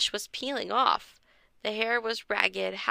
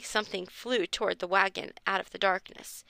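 ordinary delivery

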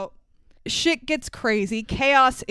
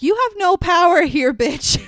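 higher, louder mock yell of the social worker; imitated yell; 7 semitones higher, 5 dB louder than usual. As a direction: bark it at him like an angry official. Social worker yelling at Captain Sharp.

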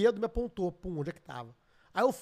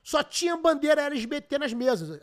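usual speaking voice

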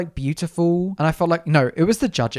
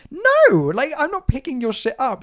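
high, loud mock-outraged no; indignant refusal; 10 semitones higher, 5 dB louder than usual. Storyteller refusing to pick up scammer's coins, high mock indignation.

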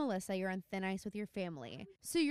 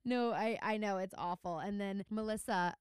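ordinary delivery

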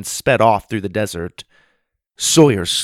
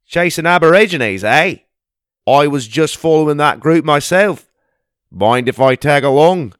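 put-on Australian accent; 5 semitones higher, 7 dB louder than usual. Aussie bushman greeting the kids in the pond, hearty Australian accent. Gundy speaks.

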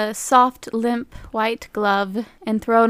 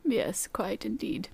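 whispering; 11 dB quieter, slower than usual. Real, not acted. Aside between brothers.

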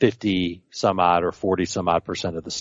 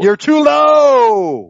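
drawn-out teasing yell; 9 dB louder, much slower than usual. Pretend. Mock outcry at low projection.

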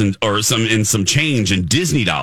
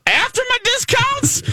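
very high-pitched shocked question; shocked squeak. Retold or imitated. Retelling the huge bill, a high shocked squeal. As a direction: squeal it in disbelief at the price.

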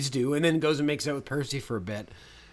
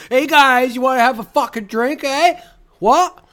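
loud, much higher hammed-up Canadian accent; mock Canadian accent; 9 semitones higher, 8 dB louder, slower than usual. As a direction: crank up a cartoon Canadian accent, eh. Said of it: "her Canadian accent".

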